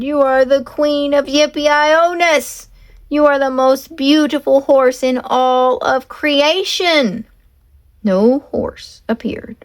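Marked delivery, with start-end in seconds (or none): 0.0-7.2 high, eager praise called out; shouted compliments. Acted. Leroy calling compliments to lure his horse, shouting. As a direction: flatter the horse at full voice. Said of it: "Leroy shouted".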